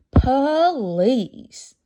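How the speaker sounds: drawn-out, eager please. A narrator's reading. Eager plea for dessert.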